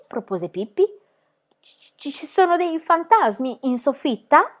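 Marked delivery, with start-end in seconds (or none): 1.6-4.5 stammering, first word whispered, then high and slow; frightened stammer. A narrator's reading. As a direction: stutter out the question in fear. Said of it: "balbettò Annika". (balbettando impaurita)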